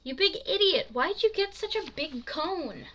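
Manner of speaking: high, loud exasperated scolding; annoyed. A narrator's reading. Annoyed friend teasing Bo over his cone, exasperated. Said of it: "Jamie's annoyed and gets his attention".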